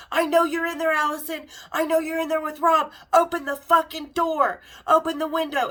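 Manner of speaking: a jealous man shouting through a car window; retold furious yelling. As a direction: pound on the door and yell to be let in. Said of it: "he's freaking out".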